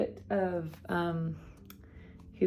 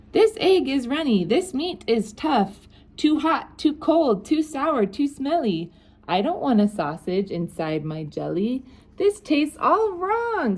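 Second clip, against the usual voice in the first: higher-pitched grumbling king complaining; fussy king; 7 semitones higher than usual. Acted. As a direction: turn your nose up at every dish. Picky king rejects every dish.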